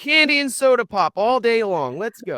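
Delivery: put-on high, gleeful call to the kids; playful grandparent voice rallying the grandkids; mock excited grandparent. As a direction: cheer them on like an indulgent grandparent. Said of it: "And you're just like".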